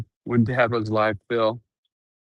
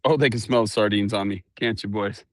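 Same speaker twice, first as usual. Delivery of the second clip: usual speaking voice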